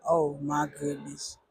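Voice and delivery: low, soft embarrassed sigh; soft dismay. Retold or imitated. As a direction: breathe it out in dismay. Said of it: "I just be like".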